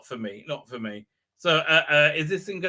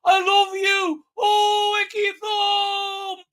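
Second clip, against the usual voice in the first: high, slow swooning shout; imitating the Doctor; 5 dB louder, much slower than usual. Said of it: "gonna say".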